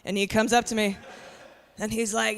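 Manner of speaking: ordinary delivery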